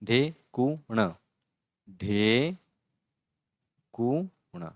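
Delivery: ढे कु ण, slow, one syllable at a time. Shown as a demonstration. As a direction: sound out each syllable slowly. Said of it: "ये देखो".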